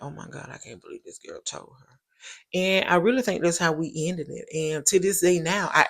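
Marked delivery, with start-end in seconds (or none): 0.0-2.0 much softer hushed "Oh my God"; stunned inner thought. Retold or imitated. The caught girl sitting blank-faced, thinking in a hush. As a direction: mouth her shock almost silently.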